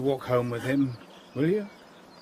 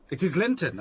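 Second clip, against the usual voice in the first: ordinary talk, nothing marked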